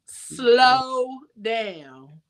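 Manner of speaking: long, lingering vowels for emphasis; drawn-out emphasis. Real, not acted. Host repeats her key advice to listeners, drawn-out and emphatic. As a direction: stretch each word out slowly.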